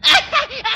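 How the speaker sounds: burst of laughter. Real, not acted. [name] laughs at her act.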